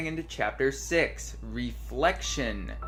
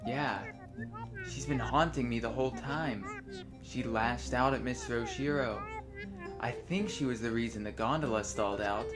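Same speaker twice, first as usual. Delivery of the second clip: softly reading dialogue lines aloud; reading game dialogue; 5 dB quieter than usual. Acted. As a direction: read the lines like a script. Reads both characters' dialogue.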